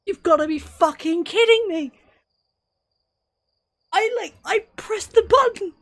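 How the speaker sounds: high, loud protesting yell; pleading his case to the game; frustrated shouting. Real, not acted. Player protesting to the game he pressed the button, frustrated shout.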